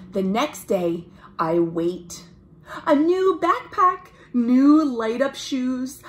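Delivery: put-on lively read-aloud for children; story narration. A narrator's reading. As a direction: tell it brightly to young listeners. Reads picture book aloud.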